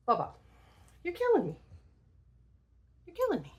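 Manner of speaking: high, exasperated talk to a pet; playfully fed up with the dog; playful scolding to dog. Real, not acted.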